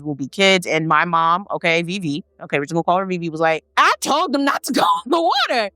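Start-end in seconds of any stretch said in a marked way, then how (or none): 3.8-5.7 much higher, laughing imitation of mom; high laughing parody of the mother; mock told-you-so. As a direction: tease her I-told-you-so in a squeaky voice. Mocking mom's told-you-so.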